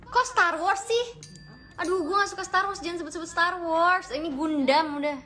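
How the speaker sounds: high, loud indignant whine; loud protest. Real, not acted. Protests being called Star Wars.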